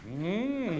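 high, drawn-out "mm"; disapproving stiff-neck hum. Retold or imitated. Stiff necks at a symphony scolding applause, mock disapproving hum. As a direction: hum like an offended concertgoer. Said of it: "all the stiff necks, they go".

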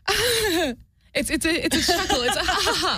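high chuckling dominatrix laugh; evil laugh. Shown as a demonstration. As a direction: chuckle upward, high and teasing. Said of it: "let's hear the dominant evil laugh".

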